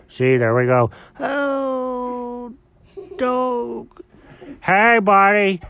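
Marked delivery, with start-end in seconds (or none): 1.2-4.0 soft, high, strained croon; lost voice. Acted. Cat waking after a wild night, lost voice. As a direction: moan it with a spent voice. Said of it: "I lost my voice".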